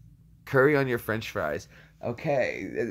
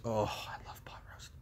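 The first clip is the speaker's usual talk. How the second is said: very soft, low whisper; whispered relish; 7 semitones lower, 15 dB quieter than usual. Pretend. Streamer savouring thought of pot roast, whispered relish. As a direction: sigh it out in a hushed whisper.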